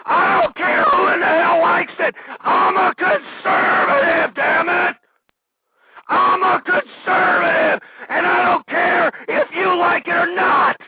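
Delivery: high, loud, angry yelling; furious shout. Real, not acted. Host shouting at his audience about being conservative, furious. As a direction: bellow it out in defiance.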